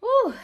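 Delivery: high, loud ooh of relief; relieved sigh. Shown as a demonstration. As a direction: let the worry out in one breath. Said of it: "her mother sighed ... in relief".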